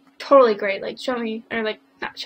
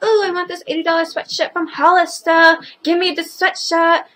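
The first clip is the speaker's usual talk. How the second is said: mock whiny kid, a bit higher; lightly voiced bratty demand; spoiled kid voice; 5 semitones higher, 5 dB louder than usual. Vlogger imitates kids demanding a Hollister sweatshirt, mock whine. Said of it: "kids are like".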